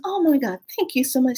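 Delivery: high-pitched, gushing thanks; grateful lady. Retold or imitated. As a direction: imitate a relieved, grateful neighbor. Retold lady thanking for returned packages.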